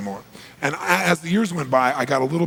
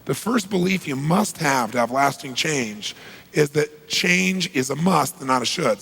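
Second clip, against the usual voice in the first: ordinary talk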